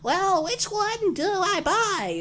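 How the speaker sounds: squeaky granny asking a clerk, slowly; old lady customer. Retold or imitated. Customer quoted to the clerk in a high, slow old-lady voice. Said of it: "She goes".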